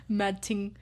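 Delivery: mock London rap slang accent; playful UK street-accent imitation; British rapper. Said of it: "making all of the British rappers".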